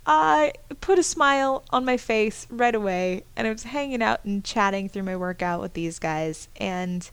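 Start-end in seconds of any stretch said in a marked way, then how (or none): none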